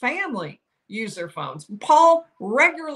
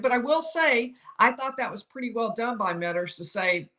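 ordinary delivery

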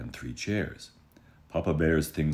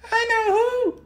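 extremely high, louder little-cub voice; a proud tiny cub detective; Baby Bear; 9 dB louder than usual. Acted. Baby Bear announcing his discovery, tiny high proud voice. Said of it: "said Wee Bear".

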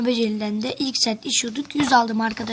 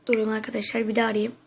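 ordinary talk, nothing marked

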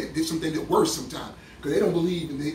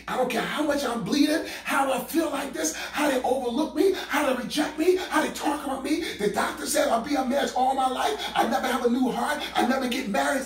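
higher, pleading imitation of a doubter; mock doubting woman; 7 semitones higher than usual. Preacher mimics a woman's doubting complaints, higher and pleading. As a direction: play the downcast woman listing her troubles.

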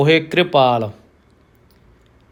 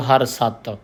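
ordinary talk, nothing marked

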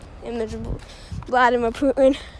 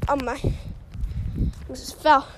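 ordinary talk

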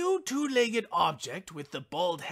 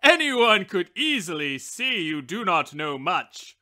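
loud, lower, condescending put-down; scornful wishbone; 3 semitones lower, 6 dB louder than usual. A narrator's reading. Wishbone sneering at the matchbox, loud and condescending. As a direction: talk down to the matchbox like a snob. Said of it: "replied the wishbone".

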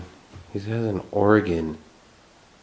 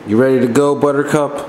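calling out; 7 semitones higher, 10 dB louder, faster than usual. Real, not acted. Calling out to companion.

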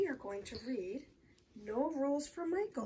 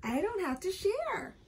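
put-on high, childish sing-song refusal; taunting refusal; 7 semitones higher than usual. A narrator's reading. Rachel will not return Michael's piece, taunting refusal. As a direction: tease him with a raised voice.

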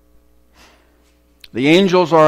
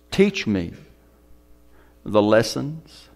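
hushed prayer; 6 semitones lower, 4 dB quieter than usual. Acted. Preacher sharing his simple prayer, hushed. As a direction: pray it quietly to yourself.

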